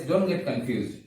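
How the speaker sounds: normal talking voice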